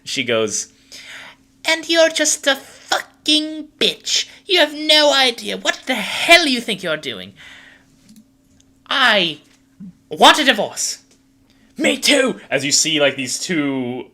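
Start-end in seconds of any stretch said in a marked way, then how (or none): 1.6-11.0 very high, shrieking wife voice; hammed-up marital fury at high pitch; quarrelling wife. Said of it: "the woman who is ... She goes". 11.8-12.4 high, loud screeched comeback; hammed-up angry yell; shouted retort. Spouse screams back in acted quarrel.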